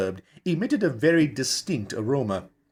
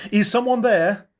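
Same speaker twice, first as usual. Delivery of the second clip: high, loud nervous call; calling out nervously; 10 semitones higher, 5 dB louder than usual. A narrator's reading. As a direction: call into the dark, half afraid. Calling out on dark moor.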